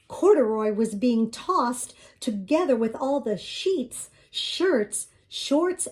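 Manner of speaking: usual speaking voice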